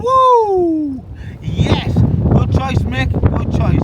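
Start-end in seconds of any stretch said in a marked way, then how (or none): 0.0-1.9 loud delighted whoop; excited cheer. Real, not acted. Excited cheer at good beer.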